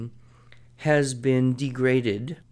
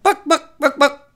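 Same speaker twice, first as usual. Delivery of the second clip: shrill high squawking chicken cry; 7 dB louder than usual. Acted. Telling a joke, the chicken squawks loudly. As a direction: squawk it out like a hen.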